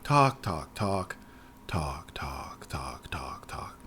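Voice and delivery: repetition sinking to a whisper; fading talk. Retold or imitated. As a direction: let the droning die away. Mocking endless dialogue, fading out.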